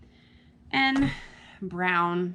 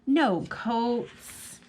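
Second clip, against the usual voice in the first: usual speaking voice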